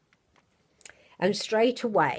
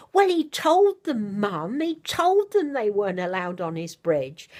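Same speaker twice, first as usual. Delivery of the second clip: earnest little voice; 3 semitones higher, 4 dB louder than usual. Retold or imitated. Mother imitating her son protesting about the bridge. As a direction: plead it like an indignant small child. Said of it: "in such an earnest little voice".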